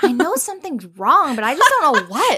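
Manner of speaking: high, puzzled mock voice; hammed-up cluelessness; clueless man. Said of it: "Dario is so clueless".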